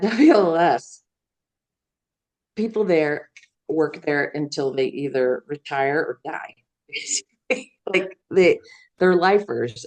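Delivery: ordinary talk, nothing marked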